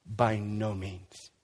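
By no means, drawn out very slowly, low and heavy; slow emphatic denial. Acted. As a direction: let each word land, by... no... means. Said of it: "he opens up chapter six like this".

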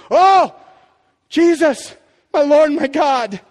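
a loud, slow cry of worship; awed exclamation. Retold or imitated. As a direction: burst out in awe. Thomas's conversion, contrast.

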